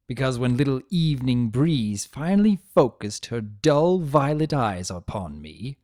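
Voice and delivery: plain reading voice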